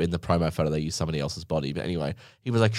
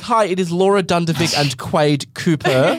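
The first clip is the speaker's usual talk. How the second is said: overly polite announcement; 7 semitones higher, 4 dB louder than usual. Retold or imitated. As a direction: read it out like a very proper help call with a laugh in it. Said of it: "Whereas you'd be like".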